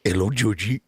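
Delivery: a hint of a creepy clown; lightly voiced creepy clown greeting. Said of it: "I do have my clown voice".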